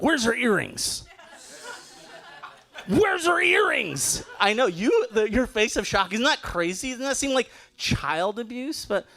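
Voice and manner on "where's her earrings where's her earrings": imitating mom. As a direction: demand it like a shocked traditional mother. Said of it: "my mom said was like".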